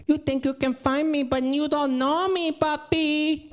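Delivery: high, sing-song fake Spanish accent; hammed-up high Spanish-wife impersonation; mock Spanish accent. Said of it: "It's an exact impersonation".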